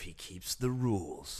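low, slow, grave announcer voice; authoritative voice. Pretend. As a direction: intone it like a movie trailer. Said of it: "that sounds very authoritative".

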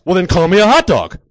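loud, high retort; worked-up comeback; exasperated demand. Acted. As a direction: throw it back at him in a shout. Snaps back at friend.

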